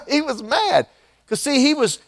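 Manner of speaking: amused at grumpy Jonah; laughing remark on Jonah's temper. Real, not acted.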